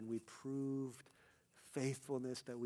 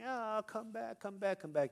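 high-pitched child's plea, imitated; mock wailing; 9 semitones higher than usual. Child left at school by parents, mock wailing. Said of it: "he starts wailing, wailing".

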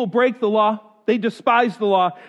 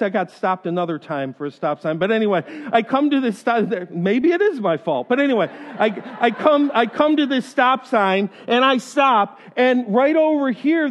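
normal talking voice

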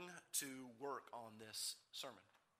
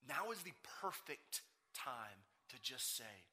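ordinary talk, nothing marked

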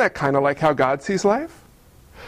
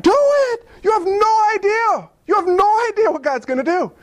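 emphatic urging; 10 semitones higher than usual. Pretend. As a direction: shout encouragement. Saints urging us.

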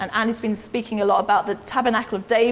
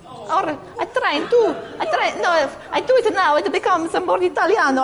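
put-on a Mexican accent slipping into Italian, high; failed Mexican accent; 6 semitones higher than usual. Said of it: "I'm not very good at the Mexican".

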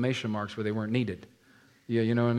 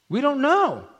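high, louder, shrugging "We don't know."; evasive parents; 13 semitones higher, 6 dB louder than usual. Acted. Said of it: "They said".

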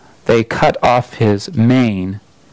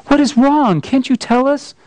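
higher, anxious plea; worried girl; 12 semitones higher than usual. Acted. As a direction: ask like a frightened little girl. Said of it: "said Lucy".

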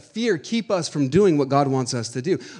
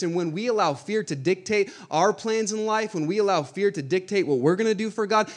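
normal talking voice

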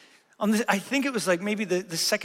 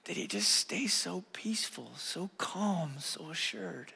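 hushed, wondering whisper under the breath; awed whisper; 3 semitones lower, 11 dB quieter, slower than usual. Retold or imitated. Pastor recalling his morning reading, whispering in awe. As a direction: breathe the question in quiet amazement. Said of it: "I just was sitting there going".

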